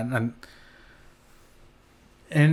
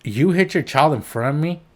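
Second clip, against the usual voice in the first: ordinary talk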